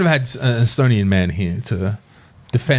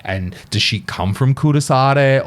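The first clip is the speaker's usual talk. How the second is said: normal talking voice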